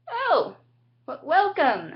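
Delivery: high startled "oh" then a stammered welcome; awkward surprise. Retold or imitated. Teens meeting the odd new doctor, startled stammering welcome. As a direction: caught off guard, stumble into politeness.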